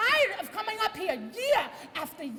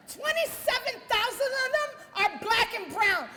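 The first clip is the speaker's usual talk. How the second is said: ordinary talk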